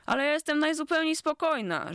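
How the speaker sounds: high, bristling insistence; indignant protest. A narrator's reading. Kuka protests to her friends that she is calm, high and indignant. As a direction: bristle at the suggestion, pitch jumping up. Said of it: "żachnęła się Kuka".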